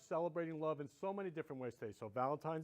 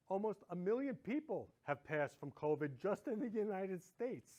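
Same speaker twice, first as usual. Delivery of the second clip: ordinary talk, nothing marked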